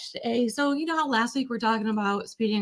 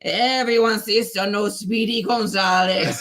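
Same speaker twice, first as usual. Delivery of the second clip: drawling cartoon Mexican accent; put-on Mexican accent, stretched out; Slowpoke Rodriguez. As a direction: do a sluggish mouse with a Mexican accent. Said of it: "Can you do the Slowpoke accent?".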